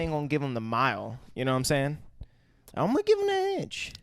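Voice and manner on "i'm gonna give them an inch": much higher, stretched-out declaration; high-pitched emphatic declaration. Real, not acted. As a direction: sing it out like a motto. Stressing his motto.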